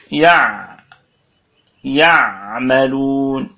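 "يعملون" very slow, with ع clearly held; easy ayn demo. Shown as a demonstration. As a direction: dwell on ع in "يعملون", then stretch the ending. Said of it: "عندك سهولة في مخرجها".

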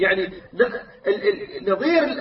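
ordinary talk, nothing marked

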